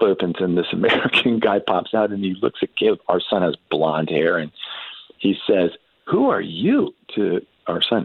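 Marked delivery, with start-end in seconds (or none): 6.1-6.9 high-pitched, incredulous question; acted astonishment. Tank soldier to a blond American boy, astonished. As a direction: voice leaps up in surprise.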